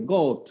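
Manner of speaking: গট with the mouth shaped round for ও. Shown as a demonstration. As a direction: round the lips into ও for গট. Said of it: "মুখটাকে ও এর মতো বানাইতে হবে". (ঠোঁট গোল করে ও-এর মতো গট)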